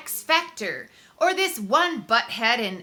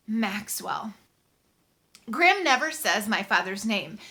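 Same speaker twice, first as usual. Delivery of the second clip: ordinary delivery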